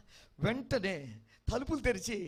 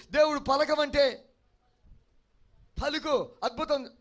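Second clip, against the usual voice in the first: louder, higher fervent pulpit preaching; preaching at full force; 4 semitones higher, 6 dB louder than usual. Acted. Preacher urging the congregation to obey God, fervent full-force preaching. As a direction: drive each promise home like a revival preacher. Preaches loudly about miracles.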